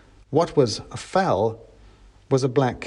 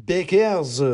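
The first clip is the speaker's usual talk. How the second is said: high-voiced correction; mouse revolutionary; 7 semitones higher than usual. A narrator's reading.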